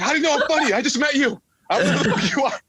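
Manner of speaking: high, squeaky mock disbelief; a thin nervous voice, thrown up high in panic; incredulous inner voice. Nervous first-timer answering the host who called him funny, squeaky high disbelief.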